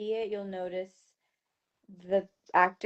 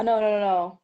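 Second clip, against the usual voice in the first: ordinary talk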